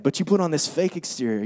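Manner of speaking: ordinary talk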